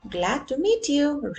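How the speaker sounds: cheerful Rex. Acted. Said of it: "Rex replied".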